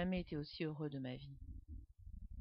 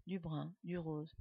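usual speaking voice

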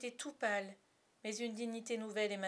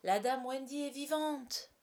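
slow, loud, higher proclamation; joyful exclamation; 5 semitones higher, 6 dB louder, slower than usual. A narrator's reading. La plume announces Wendy is alive, exclaiming. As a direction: proclaim the wonderful news, stretching it out. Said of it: "la plume s'exclama".

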